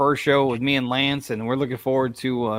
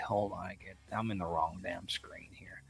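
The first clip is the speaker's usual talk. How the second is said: muttered under his breath, much softer; quiet aside; 6 semitones lower, 16 dB quieter than usual. Real, not acted. Host muttering to himself at the wrong screen, whispered. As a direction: grumble it to yourself, off-mic.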